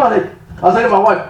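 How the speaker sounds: usual speaking voice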